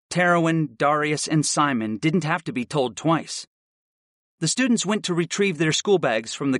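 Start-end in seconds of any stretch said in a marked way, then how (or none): none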